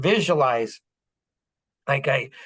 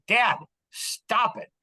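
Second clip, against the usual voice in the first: higher, slow, spaced-out command; measured, mildly insistent order, pitched up; imitating firm son; 7 semitones higher, slower than usual. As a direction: lightly act out a son cutting through dad's panic. Voices son halting his panic.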